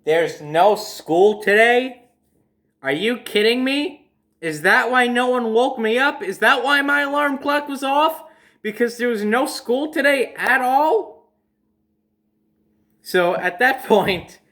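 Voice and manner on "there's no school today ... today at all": put-on a kid who can't believe he got up for nothing, voice climbing; stunned disbelief. Kid to his aunt learning there's no school, high disbelieving whine.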